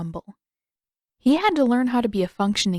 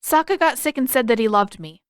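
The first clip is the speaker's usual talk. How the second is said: blurting out; 4 semitones higher, 5 dB louder than usual. A narrator's reading. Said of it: "he burst out with".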